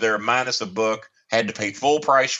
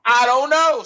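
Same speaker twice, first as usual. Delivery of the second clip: exasperated I don't know; 13 semitones higher, 5 dB louder, slower than usual. Real, not acted. Asked where coupon book went.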